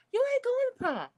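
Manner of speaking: very high, shrill ruling; furious mom. Pretend. A mother banning her daughter from prom, furious. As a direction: shout the verdict down at her.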